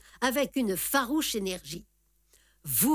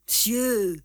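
drawn-out indignant retort; irritated; 3 dB louder than usual. A narrator's reading. Quarrelling gambler answering the officer back, irritated. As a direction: throw it back, affronted. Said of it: "deux voix irritées s'élevèrent".

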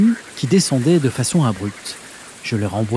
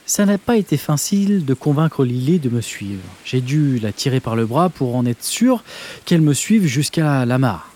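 ordinary delivery, nothing marked

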